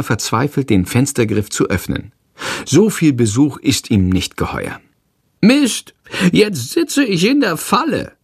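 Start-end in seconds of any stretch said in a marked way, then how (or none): 5.4-8.1 high, frantic little creature cursing; a trapped bat swearing at the window. A narrator's reading. Trapped bat Shandor curses at the stuck window, high. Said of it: "flucht er".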